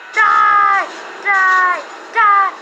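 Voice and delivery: yelling at enemies; loud battle cry; shouting. Real, not acted. Shooting enemies in game.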